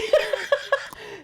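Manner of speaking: high, loud burst of laughter; real laugh. Real, not acted. Laughing at her own magazine story, high and loud.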